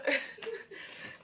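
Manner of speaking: high embarrassed laugh; a sudden high laugh after tears. Real, not acted. Vlogger laughing off her crying, high laugh.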